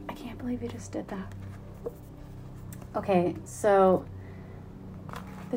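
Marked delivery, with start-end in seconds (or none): 0.1-1.3 hushed, breathless disbelief; awed whisper. Retold or imitated. The host recalls reading the book, a stunned whisper. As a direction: whisper it like you can't believe what you just read. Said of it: "That I was like".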